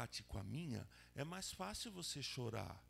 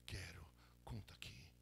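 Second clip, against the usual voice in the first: much lower, whispered invitation; Jesus whispering to the sick man to tell his story; soft whisper; 9 semitones lower, 7 dB quieter than usual. Acted. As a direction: lean in and whisper it tenderly.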